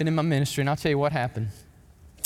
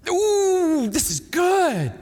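high, loud ooh then slow; excited relish; 11 semitones higher, 5 dB louder, much slower than usual. Real, not acted. Pastor relishing the closing word, loud and high. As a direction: savour the point. Preacher relishing his point.

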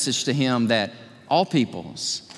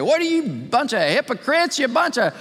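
mock angry retort; 9 semitones higher than usual. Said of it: "get mad, say".

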